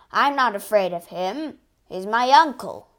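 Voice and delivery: loud, proud giant's boast; boastful Cyclops. Acted. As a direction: brag like a fearless monster.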